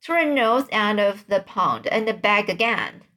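usual speaking voice

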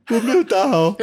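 high, loud mock wail; imitating a distressed young boxer; upset boy. Coach acting out the boxer upset after his match, high mock wail. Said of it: "他难过啊".

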